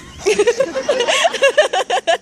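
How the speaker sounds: high cackling laugh. Real, not acted. Laughs at own punchline.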